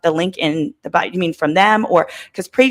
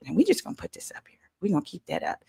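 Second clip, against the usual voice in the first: fast whispered aside; whispering; 12 dB quieter, faster than usual. Real, not acted. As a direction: mutter it in a whisper. Whispers aside posting photo.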